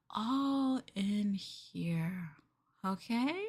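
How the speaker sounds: slow. Acted. Reassuring captive partner.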